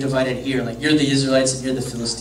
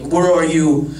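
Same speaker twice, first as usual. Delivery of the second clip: mocking giant; 5 dB louder than usual. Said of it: "he's just mocking them".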